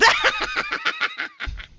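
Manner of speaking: high-pitched hag cackle; sea hag giggling. Acted. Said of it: "the sea hag is giggling".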